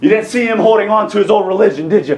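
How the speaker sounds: ordinary delivery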